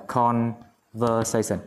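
"conversation" slowly, split into parts; syllable by syllable. Shown as a demonstration. Teacher writing the word up for students, syllable by syllable. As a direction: stretch out each syllable of "conversation".

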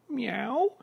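high mock cat meow from a duck. Duck fooling Mabel, high mock meow.

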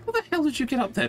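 high, fast disbelief; can't believe you got up there; incredulous. Real, not acted. Stuck below, teammate climbed up.

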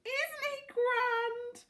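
very high, drawn-out admiration; high-pitched, slow, proud delight. A narrator's reading. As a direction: gush with pride over a pet. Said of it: "Missus Silver said proudly".

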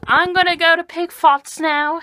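high, loud put-on British accent; terrible British accent. Cosplayer signing off to go to Pig Farts, loud mock British accent. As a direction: announce it in a badly faked British accent. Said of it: "so that's my terrible accent".

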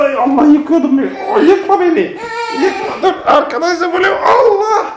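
laughing yelps as she topples over; laughing while falling. Real, not acted. Mother knocked over by her son, laughing and yelping. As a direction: giggle through the panic of falling.

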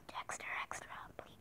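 urgent whispered plea. Real, not acted. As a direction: whisper the plug pleadingly. Whispered plug for second channel.

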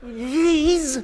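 high-pitched, drawn-out reply; startled answer. Retold or imitated. Speaker answering the man who hollered, high startled yelp.

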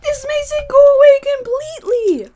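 high, loud overjoyed relief; ecstatic relief. Real, not acted. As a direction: shout it out, delighted. Yogurt relief.

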